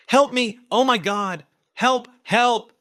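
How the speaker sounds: shrieking high, loud cries of peril; fake screams for help. Ivy fakes being attacked to lure others, shrieking. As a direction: scream like a woman in mortal danger. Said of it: "she yelled".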